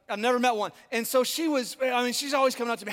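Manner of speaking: ordinary talk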